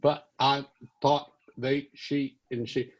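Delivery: halting, slow, cowed protest; tongue-tied sputtering; stammering. Retold or imitated. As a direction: imitate a flustered kid failing to get a word in. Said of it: "He just kept screaming, and I was going".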